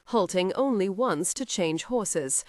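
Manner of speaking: usual speaking voice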